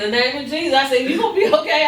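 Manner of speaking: high, loud alarmed plea; alarmed pleading. Retold or imitated. Grandmother alarmed at her delirious grandson, loud pleading. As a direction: cry out to Jesus in fear.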